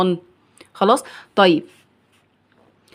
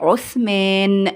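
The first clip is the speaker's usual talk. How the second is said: very slow, long held ending; drawn-out pronunciation; much slower than usual. Shown as a demonstration. Teacher restoring the word's alif and nun, drawn out. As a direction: give the full word syllable by syllable. Saying the restored full word.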